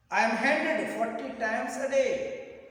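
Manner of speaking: loud, high recited verse line; poem reading. Shown as a demonstration. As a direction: declaim the line loudly to a class. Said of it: "I'll read the poem slowly".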